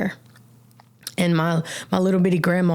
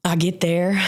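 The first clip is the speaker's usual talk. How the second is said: ordinary talk, nothing marked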